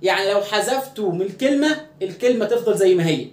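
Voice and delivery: usual speaking voice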